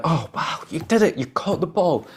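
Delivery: put-on high, amazed cheer for a child; delighted praise. Parent to child who just caught the ball, delighted. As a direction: gush with acted parental delight.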